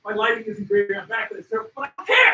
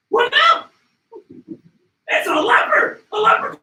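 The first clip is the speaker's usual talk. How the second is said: high, loud, thrilled cries; excited shout; 11 semitones higher, 11 dB louder, slower than usual. Real, not acted. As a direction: yell it out in disbelief. A leopard shows up.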